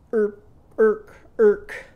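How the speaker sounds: high, loud barking seal call. Shown as a demonstration. Teacher barks like a seal for the class. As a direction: yelp it out like a seal.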